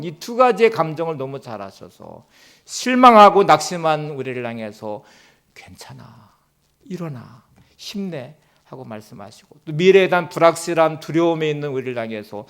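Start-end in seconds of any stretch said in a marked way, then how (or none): 5.5-8.3 much softer, lower reassurance; God comforting the weary, soft and tender; gentle comfort. Retold or imitated. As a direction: hush it like consoling a hurt child. God's comforting words voiced.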